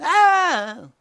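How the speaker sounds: high, loud whiny yelp; sudden high kid's cry of protest; mock kid shriek. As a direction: squeal like a poked little brother. Poked kid cries out.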